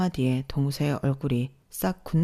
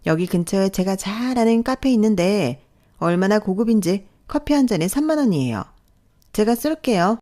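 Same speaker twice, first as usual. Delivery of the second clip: higher, showy sister-in-law voice; boastful sister-in-law; 5 semitones higher, 5 dB louder than usual. Acted. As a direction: brag about a fancy treat. 동서가 카페를 자랑함. (잘난 척하는 동서 말투)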